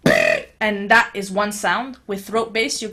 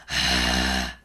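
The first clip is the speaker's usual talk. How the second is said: low rumbling throat growl. Shown as a demonstration. Teacher demonstrating the growl sound. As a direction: growl deep at the bottom. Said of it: "reverberation at the bottom end of that sound".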